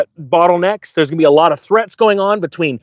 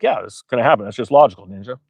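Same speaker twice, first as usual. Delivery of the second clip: low, dopey agreeing viewer; lightly voiced smug viewer; mock viewer voice; 9 semitones lower, faster than usual. Voicing viewers agreeing with him.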